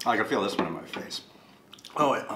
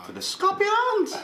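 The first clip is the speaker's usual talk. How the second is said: high, slow squeaky falsetto; squeaky joke voice; 6 dB louder, slower than usual. Pretend. Joking about sauce sensitivity.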